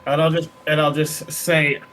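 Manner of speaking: normal talking voice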